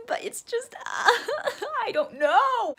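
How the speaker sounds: high, cracking laugh mid-words; laughing fluster. Real, not acted. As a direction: laugh it off, helpless. Flustered over Aspen.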